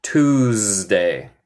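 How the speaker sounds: Tuesday with the S held as a zzz; buzzing S. Shown as a demonstration. Teacher shows the S sounds like a bee, buzzing S. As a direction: lean on the buzzy Z in "Tuesday". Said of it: "ese S suena más como abeja".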